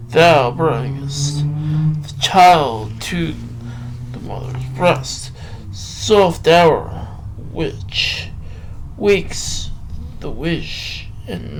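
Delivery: slow, measured poetry reading with long pauses; poem recital. Acted. Reading a Byron poem to listeners, slow recital. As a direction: let each line breathe like a recital.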